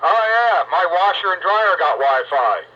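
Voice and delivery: normal talking voice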